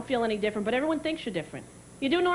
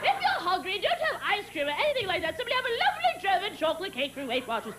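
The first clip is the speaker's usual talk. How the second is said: high, sing-song spokeswoman; mock ad pitch; 9 semitones higher than usual. Comic parodies a diet cake commercial, high mock ad pitch. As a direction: sell it brightly, pitch climbing to a peak.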